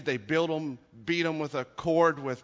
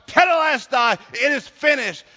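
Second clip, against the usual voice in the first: high, loud proclamation; exultant cry; 11 semitones higher, 11 dB louder than usual. Retold or imitated. Preacher voicing an artist at the final stroke, exultant cry. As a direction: burst out with it at full volume. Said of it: "he springs out".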